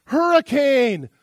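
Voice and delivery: loud, high, slow yell of a fake alarm; desperate shout. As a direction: yell it to distract everyone. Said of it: "He goes".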